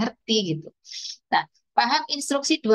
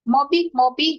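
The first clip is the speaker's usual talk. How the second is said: put-on higher, louder childish mispronounced "Mobi, mobi"; toddler word; 6 semitones higher, 4 dB louder than usual. Shown as a demonstration. Teacher imitating a toddler naming a toy car, high and childish. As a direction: say "Mobi, mobi" like a two-year-old wanting the car. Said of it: "belum clear gitu ya".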